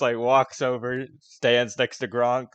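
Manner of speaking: normal talking voice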